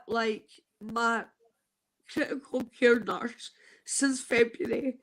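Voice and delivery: ordinary talk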